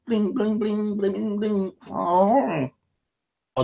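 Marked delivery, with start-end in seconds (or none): 0.1-1.7 very high squeaky bling, bling; phone blinging. Retold or imitated. Phone keeps chiming, mimicked very high. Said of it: "Bleat for about five minutes".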